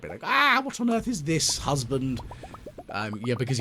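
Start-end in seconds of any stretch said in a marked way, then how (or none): 0.2-2.2 high, loud mock-horrified shriek of a wife; hammed-up fright, pitched way up and loud; Hayley petrified. Husband imagines his terrified wife finding cursed mannequins, mock-petrified. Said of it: "She'd probably be absolutely petrified".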